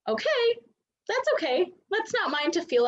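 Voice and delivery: high, light self-soothing voice; self-reassuring. Acted. Wife hearing her husband stomping, high self-reassurance.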